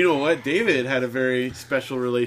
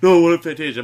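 insistent whine; 2 semitones higher, 9 dB louder, faster than usual. Retold or imitated. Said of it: "And he's like".

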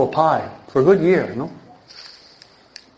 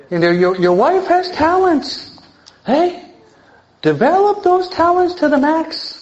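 much higher, pushing up into a rising plea; fervent preaching; 11 semitones higher than usual. Real, not acted. Preacher urging husbands to let wives grow, high and fervent. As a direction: lift the voice high and urge it hard.

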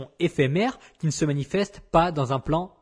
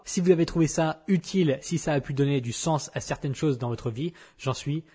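usual speaking voice

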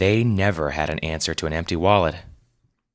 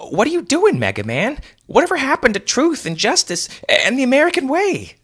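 much higher, louder frantic cry; panicked disbelief; 4 dB louder than usual. A narrator's reading. Wes panics as hero abandons him, high frantic voice. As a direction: shriek it in panic.

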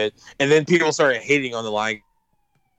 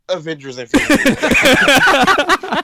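dramatic reveal; 12 semitones higher, 6 dB louder, much slower than usual. Acted. Dramatic reveal of record holder.

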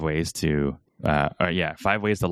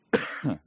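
single chesty cough from a cold; 3 semitones higher, 5 dB quieter than usual. Real, not acted. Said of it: "There's the cough".

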